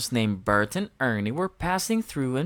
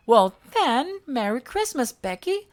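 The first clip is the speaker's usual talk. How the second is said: put-on much higher, bright childlike voice; Elmo voice; 9 semitones higher, 3 dB louder than usual. A narrator's reading. Elmo answering Smudge, high and perky. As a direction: chirp it like a cheerful little monster. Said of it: "Elmo said".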